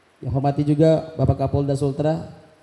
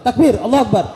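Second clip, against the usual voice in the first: takbir keras dan tinggi; seruan takbir; 7 semitones higher, 6 dB louder than usual. Real, not acted. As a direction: serukan takbir sekuat tenaga. Memimpin takbir jamaah. (penceramah mengajak jamaah bertakbir, berseru keras)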